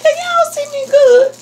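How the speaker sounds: high, loud giggly call; excited squeal. Real, not acted. Vlogger checking viewers can see her, excited squeal.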